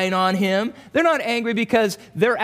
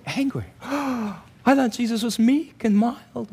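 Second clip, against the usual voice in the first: mock shocked gasp; 3 semitones higher, slower than usual. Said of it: "You're going".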